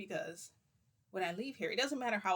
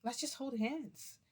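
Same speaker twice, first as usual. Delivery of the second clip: sweet; 7 semitones higher, much slower than usual. Retold or imitated. Sweet voice to Madara.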